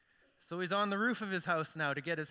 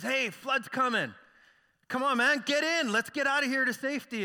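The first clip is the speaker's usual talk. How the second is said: urgent neighbor; 7 semitones higher, 6 dB louder than usual. Acted. Neighbor urging man to leave.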